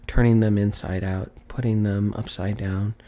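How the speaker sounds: usual speaking voice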